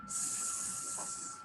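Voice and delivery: unvoiced hissing s; hissed s sound. Shown as a demonstration. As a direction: hiss the letter s. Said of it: "A bit longer".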